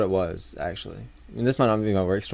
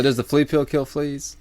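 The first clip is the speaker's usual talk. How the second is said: sarcastic; 5 semitones higher, slower than usual. Retold or imitated. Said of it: "I'm like".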